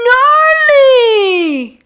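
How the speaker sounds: high, loud surfer-dude whoop; excited cry. Acted. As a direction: yell it with wild delight. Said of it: "Lucius cried".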